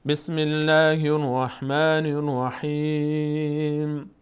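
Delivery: slow, drawn-out tajwid recitation; Quran recitation. Shown as a demonstration. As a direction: recite the surah slowly for students to follow. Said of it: "این سوره رو با هم میخوانیم". (تلاوت آهسته برای شاگردان)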